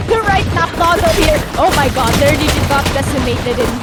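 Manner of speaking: loud, high laughing call; excited laughing. Real, not acted. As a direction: laugh and shout it out. Caster reacting to big play.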